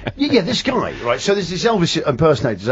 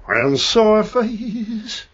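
slow, higher [name] croon; [name] impression; 4 semitones higher, much slower than usual. Teacher made him copy [name] all day. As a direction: stretch it out like [name]. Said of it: "all he was listening to was".

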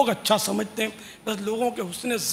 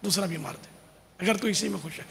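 softer, resigned mock offer; meek resignation; 2 semitones lower, 6 dB quieter, faster than usual. Preacher voicing a patient man turning the other cheek. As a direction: give in gently, much quieter. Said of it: "دوسرا رخسار پیش کر دے گا".

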